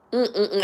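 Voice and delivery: quiet moved mm-mm-mm after a sigh; breathy sigh into humming; soft emotional hum. Real, not acted. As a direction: hum it softly, overcome. Overcome, hums after sigh.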